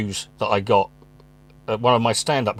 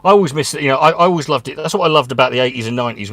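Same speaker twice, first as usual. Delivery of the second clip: normal talking voice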